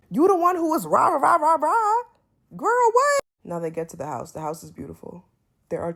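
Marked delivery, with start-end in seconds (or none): 0.1-2.0 mock rah-rah yell. Mocking Tavy's bus outburst. 2.6-3.2 incredulous. Real, not acted. Incredulous reaction to Tavy.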